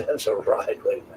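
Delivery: laughing whisper. Real, not acted. Repeats catchphrase through a laugh.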